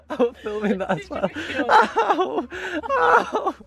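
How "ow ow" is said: sharp high yelp of pain. Real, not acted. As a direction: yelp from a bump. Smacked leg with door.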